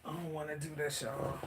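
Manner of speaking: hushed, on the verge of tears; holding back tears. Real, not acted. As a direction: barely get it out, trying not to cry. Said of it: "You're gonna make me cry".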